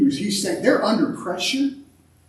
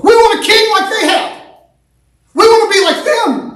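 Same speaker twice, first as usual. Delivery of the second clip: much higher and louder, a whiny mock demand; mocking Israel's demand; 14 semitones higher, 10 dB louder than usual. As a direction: mimic a crowd begging for a king. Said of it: "They wanted to look like the world".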